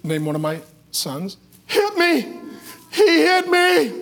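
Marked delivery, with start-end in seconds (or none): 1.7-3.9 high, drawn-out mock wail; crying kid tattling. Crying boy telling his mom on another kid, wailing. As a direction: bawl the accusation like a child after attention. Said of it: "he's kinda crying".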